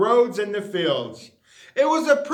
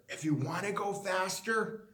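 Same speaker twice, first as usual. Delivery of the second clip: much softer, secretive uncle voice; whispered advice; 8 dB quieter than usual. A narrator's reading. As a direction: hush it into his ear. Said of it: "Vernon whispered in his ear".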